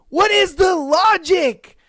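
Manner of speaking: high, loud, fed-up cry; exasperated shout at the absurdity; exasperated outburst. Real, not acted. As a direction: throw up your hands and yell it out. Exasperated rant about dating tricks.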